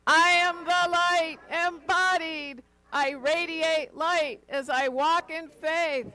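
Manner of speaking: high, loud and slow joyful shout; shouting to the heavens. Shown as a demonstration. Minister leads the congregation's affirmation, shouting it joyfully to the heavens. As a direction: lift it up and shout it to the sky. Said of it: "shouting it to the heavens".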